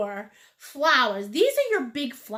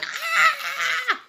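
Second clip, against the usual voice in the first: high drawn-out panicked "Ah!"; mock freak-out scream; 10 semitones higher than usual. Painter mimics students fretting over random dots, mock scream. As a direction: imitate perfectionists screaming in panic. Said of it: "my little O C Ds out there are going".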